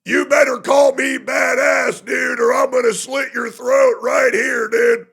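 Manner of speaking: loud, high mock threat as a cartoon villain; mock villain shout. Streamer voicing Tectone as a villain, hammed-up shout. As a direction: yell it like a silly movie bad guy. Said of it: "And he said".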